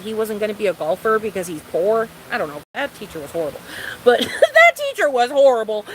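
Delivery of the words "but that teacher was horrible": louder with a laugh. Real, not acted. Ranting to viewers about her teacher, louder and laughing. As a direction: repeat it louder, laughing through it.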